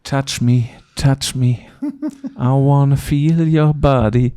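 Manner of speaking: hammed-up lover's plea, loud; mock seductive. Teasing a guest, joking as a mock seductive lover. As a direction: camp it up like a cheesy seducer. Mock romantic joke to Paul.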